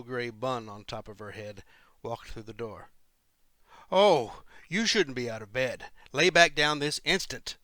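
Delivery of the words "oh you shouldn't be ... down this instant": loud, high-pitched scolding order; alarmed exclamation. A narrator's reading. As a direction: exclaim it sharply, much louder. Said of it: "she exclaimed".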